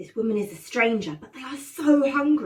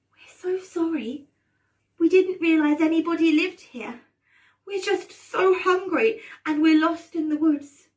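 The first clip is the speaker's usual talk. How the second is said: put-on higher-pitched, pleading child voice; apologetic children; 7 semitones higher, 4 dB louder than usual. Hansel and Gretel apologise to the old woman, pleading. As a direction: plead like sorry, hungry children.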